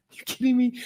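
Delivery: stunned disbelief. Retold or imitated. Reacts to manuscript offer.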